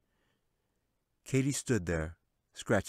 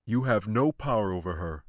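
insisting; 6 dB louder than usual. A narrator's reading. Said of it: "he insisted".

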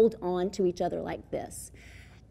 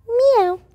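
loud, much higher falling cat "meow"; kitty meow; 12 semitones higher, 9 dB louder than usual. Shown as a demonstration. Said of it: "a kitty cat says".

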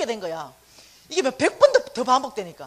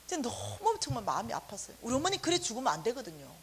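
ordinary talk, nothing marked

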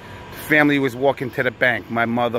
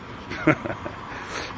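quiet laugh; 8 dB quieter than usual. Real, not acted. Laughs at own story.

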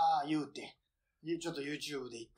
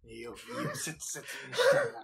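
laughing; 4 semitones higher, 4 dB louder than usual. Real, not acted. Laughing, teasing friend.

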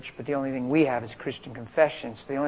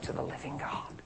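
whispered; 15 dB quieter than usual. Real, not acted. Hushed end of sermon point.

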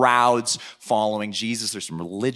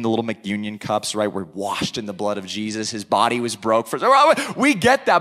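ordinary talk

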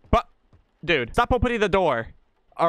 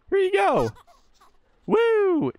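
playful cheer; slower than usual. Real, not acted. Playfully feeding the baby.

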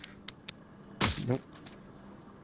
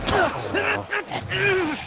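very high, loud "oh" cry; frustrated yell; 5 dB louder than usual. Real, not acted. Gamer reacting to a mishap, frustrated high yell.

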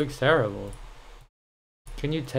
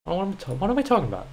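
usual speaking voice

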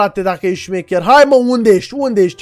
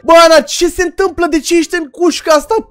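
higher, urgent worried call to a friend; alarmed; 7 semitones higher, 3 dB louder than usual. Real, not acted. Gamer asking his caged friend what happened, alarmed.

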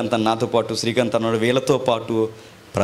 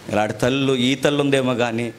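usual speaking voice

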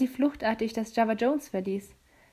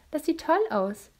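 usual speaking voice

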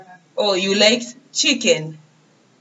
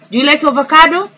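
higher, quicker and louder, "avocado" lifted; brighter repeat; 6 semitones higher, 4 dB louder, much faster than usual. Shown as a demonstration. Teacher urging the children, bright fast rise. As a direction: push it up quick to coax an answer.

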